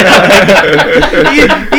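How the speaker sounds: bursts out laughing, high and loud; a real laugh at a coworker's pride; real laughter. Real, not acted. Laughing loud and high while retelling the pizza story.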